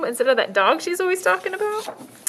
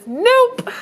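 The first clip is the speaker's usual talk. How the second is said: put-on girl's voice; 13 semitones higher, 6 dB louder than usual. Girl voiced refusing.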